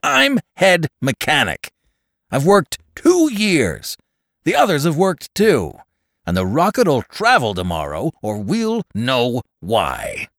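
high, loud, forceful protest; angry shout. A narrator's reading. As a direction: shout your case at the boss. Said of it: "shouted the man".

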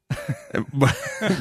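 soft high laugh trailing off. Real, not acted. Laughing at the coworker's empty reply, soft high laugh. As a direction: let a breathy laugh rise.

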